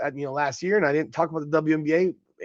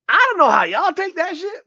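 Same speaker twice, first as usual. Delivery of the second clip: incredulous; 10 semitones higher, 4 dB louder than usual. Real, not acted. Incredulous reaction to Clark's comment.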